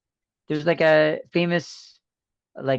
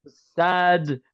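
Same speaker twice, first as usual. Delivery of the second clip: mournful sad clown whine; 3 semitones higher than usual. Pretend. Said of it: "cut that shit out".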